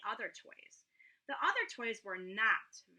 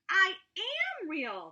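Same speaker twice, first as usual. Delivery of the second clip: high, loud, slow insistence; shouted; 9 semitones higher, 6 dB louder, much slower than usual. A narrator's reading. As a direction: shout it back defiantly. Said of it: "the rabbit shouted".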